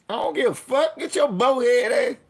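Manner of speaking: high, loud mock shout; retold yell at a dope head. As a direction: yell him up off the street.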